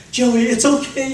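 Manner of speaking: high, firmer comforting coo; reassuring baby. Retold or imitated. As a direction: reassure him brightly and clearly. Parent soothing baby after cleanup.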